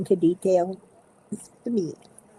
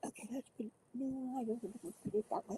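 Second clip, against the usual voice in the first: muttering; 6 semitones higher, 18 dB quieter, faster than usual. Real, not acted. Muttering to self.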